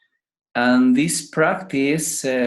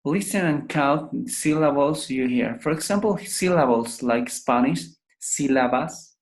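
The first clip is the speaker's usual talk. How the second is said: normal talking voice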